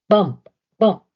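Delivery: loud punchy bump bump; bumping sound effect. A narrator's reading. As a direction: thump it out like a heavy bump.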